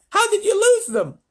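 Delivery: very high, loud demand; shouting. A narrator's reading. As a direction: yell the question in outrage. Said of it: "shouted the president".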